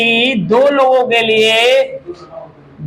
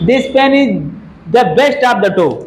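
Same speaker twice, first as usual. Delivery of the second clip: reads the wrong sentence slowly for the class; slow, even dictation pace, leaving the error for students; slow test read; much slower than usual. Shown as a demonstration. Teacher reads faulty sentence slowly to the class. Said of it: "क्या होगा?".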